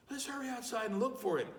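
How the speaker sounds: put-on high, fast, urgent child voice; a child rushing the others outside; eager child. A narrator's reading. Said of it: "one of the children said".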